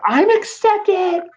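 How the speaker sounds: very high, loud, drawn-out shriek; excited squeal. Pretend. Student revealing college acceptance, squealing with excitement. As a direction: burst out with joyful news.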